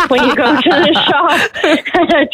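breaking into laughter mid-question; laughing. Real, not acted. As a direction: giggle through the end of the question, slowed down. Laughs at her own joke.

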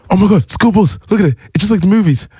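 very high, giddy gushing; wide-eyed sightseer pointing everything out; excited tourist squeal. Retold or imitated.

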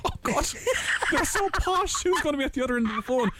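high, fast anxious worry; fretting before a scary phone call; nervous panic. Retold or imitated. Recalling nerves before phoning a posh hotel, high and fast. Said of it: "I just thought, like".

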